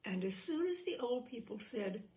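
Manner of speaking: plain reading voice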